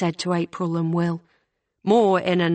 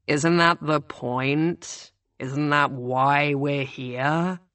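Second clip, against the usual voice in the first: lower, slow and impatient; deep, brusque girl; 3 semitones lower, slower than usual. A narrator's reading. The girl dismisses the boys' boasting, lower and slow. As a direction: lay it out slowly and impatiently. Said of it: "deep and rather brusque and impatient".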